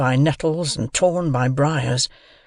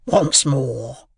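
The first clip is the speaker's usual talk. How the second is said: slow, drawn-out convict's voice; menacing convict. A narrator's reading. Convict questions a seized boy, slow and menacing. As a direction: demand it slowly, as a threatening rough man.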